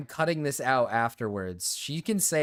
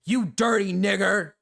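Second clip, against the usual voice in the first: loud, high hammed-up insult; angry shout; 6 semitones higher, 6 dB louder than usual. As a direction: yell the insult at him. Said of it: "And then you look at him and you go".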